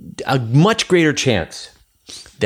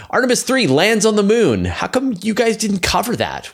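much higher, whiny imitation of an annoyed viewer; mock viewer complaint; 11 semitones higher than usual. Host mimicking a viewer who asks why they skipped the news, mock whiny. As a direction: play the indignant fan demanding coverage.

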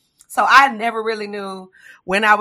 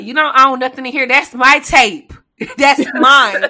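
loud, higher, mock-stern scolding; mock parent scolding; 4 semitones higher, 9 dB louder than usual. Parent scolding kid over the tape, loud mock anger. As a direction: put the kid in their place, hammed-up.

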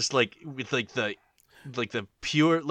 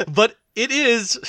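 high slow laughing reply; 12 semitones higher, 7 dB louder, slower than usual. Real, not acted. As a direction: say it through laughter. Laughing mock agreement.

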